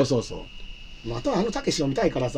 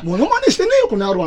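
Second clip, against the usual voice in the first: loud, rough Takeshi-style retort, pitched much higher; Takeshi impression; 7 semitones higher, 5 dB louder than usual. Father-in-law snapping at his son-in-law in a loud Takeshi voice. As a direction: bark it back like Beat Takeshi. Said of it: "たけしになっちゃってね".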